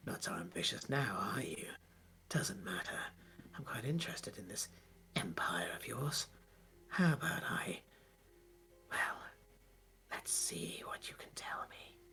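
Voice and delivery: low, soft whispering creature; sigh, then murmur deep with unhurried menace; aboleth's monster voice. Acted. Aboleth toys with the freed general, low whispery monster voice. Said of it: "aboleth goes".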